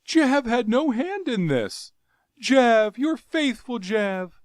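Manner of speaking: high, loud fawning plea; frightened pleading. Acted. Jav pleading innocence to his Jeddak, frightened. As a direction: beg him to believe you.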